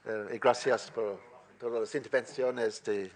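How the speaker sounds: ordinary talk, nothing marked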